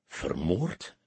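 low, soft, stunned stammer; stammering in shock. A narrator's reading. Shocked soldier stammering at the detective. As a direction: barely get the word out, dazed. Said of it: "stamelde hij".

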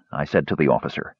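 ordinary delivery, nothing marked

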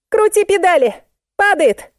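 very high-pitched panicked cry; wailing. A narrator's reading. Musenka yells back at narrator to pedal, wailing. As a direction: yell back shrilly. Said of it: "завопила в ответ".

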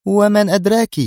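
loud, high, challenging cry; exclaimed challenge. A narrator's reading. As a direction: fire the question out loudly. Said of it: "هتفت بها نادية". (هتاف بصوت عال)

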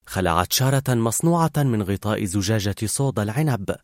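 usual speaking voice

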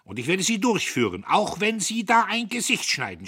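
high, loud angry vow; shouting. A narrator's reading. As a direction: shout defiantly at the foreman. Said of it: "schrie er".

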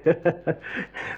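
a short, breathy amused laugh; soft chuckle. Acted. Guide amused by the wonder vehicle, soft chuckle. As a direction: chuckle to yourself at the idea.

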